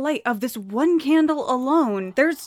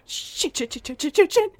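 panicked cursing; 6 semitones higher than usual. Acted. Panic after friend vanishes.

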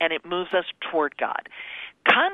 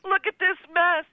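higher, rising into a fake flustered fret; mock panicked God; 7 semitones higher, 3 dB louder than usual. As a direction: ham up a hand-wringing, flustered God. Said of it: "wringing his hands in heaven saying".

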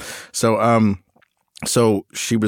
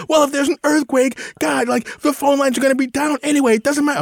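put-on much higher, whining teenage daughter; whiny teen outburst; 3 dB louder than usual. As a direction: throw a bratty teen tantrum at dad. Daughter voiced in argument.

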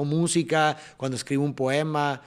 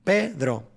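Pedro split in two, PE punched; stress on the tonic syllable. Shown as a demonstration. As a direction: lean hard on the PE of "Pedro". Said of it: "P es la sílaba tónica de Pedro". ("Pedro" recalcando la primera sílaba)

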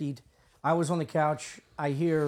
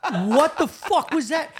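high, slow, laughing disbelief; mock astonishment breaking into laughter; retold bewilderment; 8 semitones higher, 4 dB louder, slower than usual. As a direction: draw it out in baffled, amused shock. Retold shock at sleepwalking intruder.

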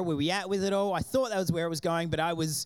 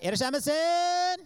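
calling out; 10 semitones higher, slower than usual. Retold or imitated. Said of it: "they would cry out".

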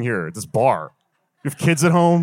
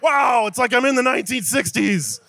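pitched-up mock shout of wonder; mock amazed voice; 11 semitones higher than usual. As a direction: squeal like you've gone back in time. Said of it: "You're like".

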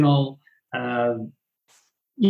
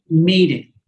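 ordinary delivery

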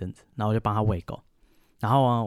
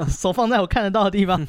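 mock police order; 7 semitones higher than usual. Police command to dogs.

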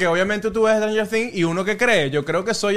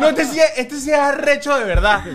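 laughing; 6 semitones higher than usual. Real, not acted. Teasing friend, laughing loudly.

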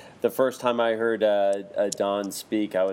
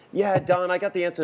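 high, eager call from the back of the room; 7 semitones higher than usual. Retold or imitated. Teacher playing both sides of the room, calling out eagerly. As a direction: pop up high like a student with an answer.

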